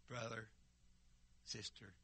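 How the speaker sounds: usual speaking voice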